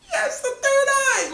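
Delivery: high, laughing squeal of delight; giddy squeal. Retold or imitated. Fan gushing over the third eye, laughing squeal.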